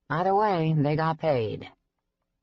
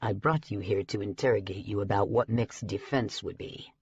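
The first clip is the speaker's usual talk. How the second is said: lower, measured voice of a stern dragon elder; lower-voiced stern elder giving the verdict; stern dragon leader; 6 semitones lower, 4 dB quieter than usual. A narrator's reading. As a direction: speak as a grave council authority delivering a verdict. Bancroft speaking.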